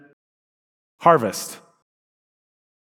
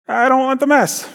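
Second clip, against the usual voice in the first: whiny; 12 semitones higher than usual. Acted. Voices the objector.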